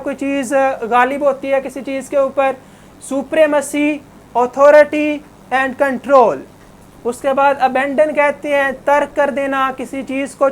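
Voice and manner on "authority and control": ordinary talk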